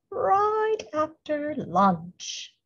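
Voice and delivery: very slow, high hissing drawl; snake voice. A narrator's reading. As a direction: stretch it out like a snake. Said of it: "said the snake".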